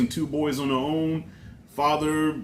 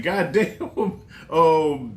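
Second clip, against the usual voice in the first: laughing; much slower than usual. Real, not acted. Reacts to twist while laughing.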